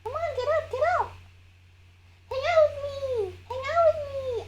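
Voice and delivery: high, sing-song pet voice; calling the cats. Real, not acted. Calling her cats for emotional support, high coaxing.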